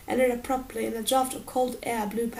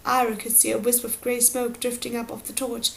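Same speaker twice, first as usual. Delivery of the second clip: usual speaking voice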